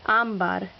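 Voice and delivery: ámbar with ÁM stressed; stress on accented syllable. Shown as a demonstration. Teacher listing words with written accents, stress on accented syllable. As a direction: lean on the first syllable of ámbar. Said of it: "words that need written accents".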